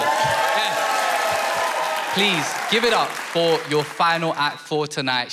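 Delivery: normal talking voice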